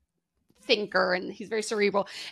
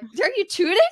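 high incredulous question, much higher; mock surprise; 10 semitones higher than usual. Asking mom in disbelief, high surprised question. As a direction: ask it in disbelief.

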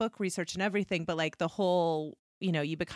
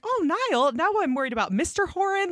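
mock fretting; 11 semitones higher than usual. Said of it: "And I was like".

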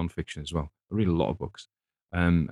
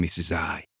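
usual speaking voice

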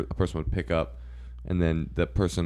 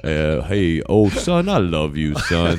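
a hint of an odd, stiff operator voice; lightly voiced relay operator; weird relay voice; 5 dB louder, slower than usual. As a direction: read it like a stranger voicing mom. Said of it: "some weird voice".

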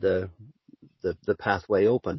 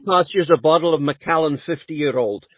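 mock sales pitch; 8 semitones higher, 7 dB louder than usual. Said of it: "are you gonna do the Scottish voice for us now".

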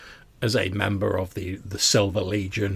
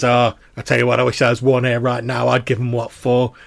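loud, higher blustering boast; animated veteran; 3 semitones higher, 9 dB louder than usual. Acted. Conductor boasts to comrades about fighting witches, animated. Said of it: "starts to get, like, a little bit animated".

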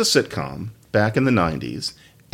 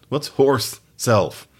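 ordinary talk

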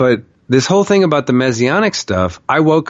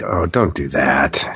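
low, slow, drawn-out mock chiding; snarky; 9 semitones lower, 4 dB quieter, slower than usual. God to a man losing hope, snarky. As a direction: imitate a teasing, snarky reproach. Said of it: "really snarky with me".

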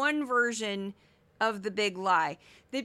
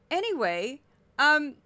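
normal talking voice

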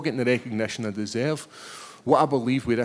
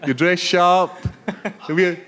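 higher and louder, playfully accusing; mock flirty teasing; 7 semitones higher, 7 dB louder than usual. Fighter jokingly accuses the sharply dressed reporter, mock flirty. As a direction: tease him like he's hitting on you.

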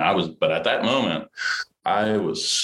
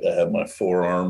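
normal talking voice

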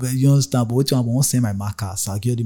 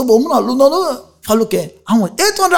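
raised haggling voice; 13 semitones higher, 5 dB louder than usual. Retold or imitated. Haggling with bus conductor.

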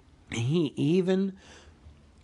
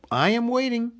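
higher, put-on bold call; trying to sound cheerful; 10 semitones higher, 4 dB louder, faster than usual. A narrator's reading. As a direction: act bold and cheerfully impatient. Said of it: "He tried to sound bold and cheerful".

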